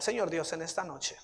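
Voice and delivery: hushed, unhurried address to God; prayer. Real, not acted. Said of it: "Vamos a orar".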